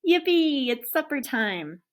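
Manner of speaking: high, loud happy cheer; excited yippee. A narrator's reading. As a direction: burst out with doggy joy. Dog excited about supper.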